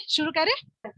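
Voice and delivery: ordinary talk, nothing marked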